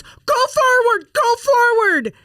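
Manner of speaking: very high, loud mock wail; imitated panicked daughter. Daughter telling mom to move the car, imitated panic. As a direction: cry out the order like a trapped kid. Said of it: "She goes".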